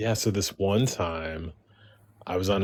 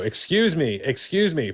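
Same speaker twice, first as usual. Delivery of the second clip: higher, insistent excuse me, excuse me; calling out to get attention; 9 semitones higher than usual. Retold or imitated. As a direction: call across the room to be noticed. Trying to get loud guy's attention.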